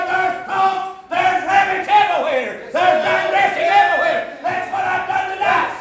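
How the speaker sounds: high, loud, shouted preaching, whooping it up; preaching at full force. Acted. Preacher shouting to his congregation about digressing, at full force. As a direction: let it ring out like a revival preacher in full flight.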